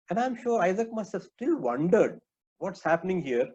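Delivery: usual speaking voice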